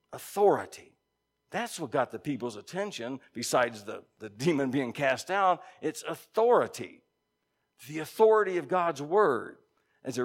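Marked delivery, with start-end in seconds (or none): none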